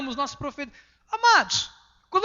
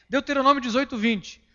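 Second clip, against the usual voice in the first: usual speaking voice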